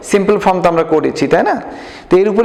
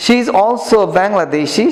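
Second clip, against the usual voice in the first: slow, careful English read-aloud for pupils; 3 semitones higher, much slower than usual. Shown as a demonstration. Teacher reading slowly to the class. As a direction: read each word slowly so students copy. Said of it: "উচ্চারণগুলো খেয়াল করবা".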